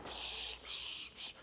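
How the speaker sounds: voiceless hissing bursts; extinguisher spray noise. Retold or imitated. People ran up with fire extinguishers, imitated spray hiss. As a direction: imitate short blasts of spray.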